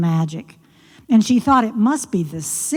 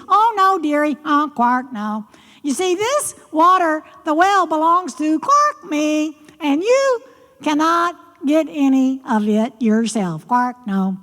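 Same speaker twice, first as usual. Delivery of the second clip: high, fast croaky frog voice with quark sounds; a cheeky frog scolding the girl; croaking frog; 7 semitones higher than usual. Acted. Frog refuses.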